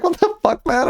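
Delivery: high startled what-the-fuck; surprised. Real, not acted. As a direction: blurt it out in shock. Shocked reaction to chat.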